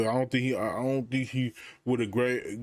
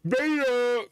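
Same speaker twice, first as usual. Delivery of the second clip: much higher, louder mock call of "Beta!"; mock mom cry; 11 semitones higher, 3 dB louder than usual. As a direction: belt out "Beta!" like a doting mother. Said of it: "he's about to say".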